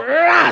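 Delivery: a short demo growl; lightly voiced growl; mock growl. Shown as a demonstration. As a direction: growl it like a fighter. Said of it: "You gotta growl".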